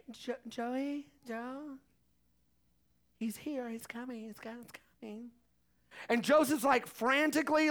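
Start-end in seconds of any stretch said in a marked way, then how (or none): 0.1-5.3 hushed, urgent whisper to Joseph; quietly urging her husband it's time; Mary urgently whispering. Retold or imitated. Mary whispering to Joseph that the baby is coming, hushed. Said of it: "Mary is like".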